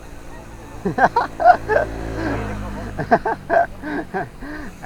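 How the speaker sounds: squealing laugh; high laugh. Real, not acted. Laughing as the climb starts.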